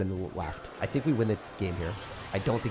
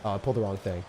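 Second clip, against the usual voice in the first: ordinary delivery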